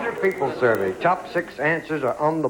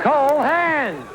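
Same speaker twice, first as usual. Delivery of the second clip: high game-show call, falling at the end; calling out answer; 8 semitones higher than usual. Real, not acted. Host asks the board for the answer, calling out.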